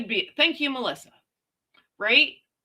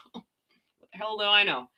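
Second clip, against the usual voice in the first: ordinary delivery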